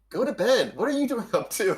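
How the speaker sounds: high, loud mock scolding; playful scolding. Real, not acted. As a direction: tease him like a nagging parent. Playfully scolding viewer Richie in chat.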